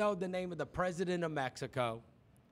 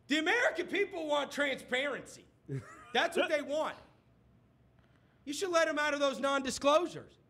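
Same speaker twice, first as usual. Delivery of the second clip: raised pitch, insistent mock demand; mock debater demand; 6 semitones higher than usual. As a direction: ham up the pushy debater. Debaters pressing Bloomberg on NDAs.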